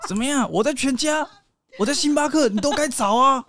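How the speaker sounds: high, laughing mock-whiny girlfriend; a playful girlfriend scolding her boyfriend back, pitch up; sassy girlfriend. Girlfriend teasing boyfriend who can't find her, high mock whine. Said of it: "然后如果是你就说".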